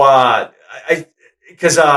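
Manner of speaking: normal talking voice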